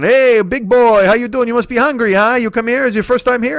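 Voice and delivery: put-on high, loud, effusive old deli owner; Joshua. Deli owner welcoming a new customer, loud and high. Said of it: "so Joshua comes walking".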